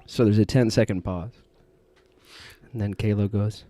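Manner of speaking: ordinary talk, nothing marked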